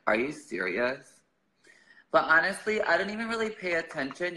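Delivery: ordinary delivery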